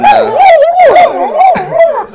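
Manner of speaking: turkey gobble. Acted. Attempting the gobble sound.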